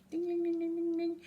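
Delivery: high ringing ding chant; school bell imitation. The lunch bell rings, high sung dings. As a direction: ring it out like the bell.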